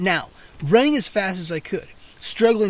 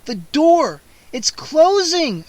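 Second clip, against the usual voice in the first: high, loud panicked shriek, slowing on closing; 9 semitones higher, 6 dB louder, much slower than usual. A narrator's reading. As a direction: scream it as the door shuts. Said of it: "I shrieked".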